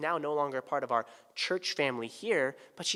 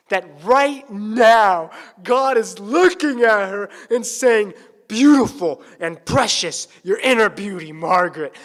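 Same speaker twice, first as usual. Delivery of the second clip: loud, high, slow and soaring; impassioned preaching; 6 semitones higher, 15 dB louder than usual. Acted. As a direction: lift the room with God's delight. Preaching climax.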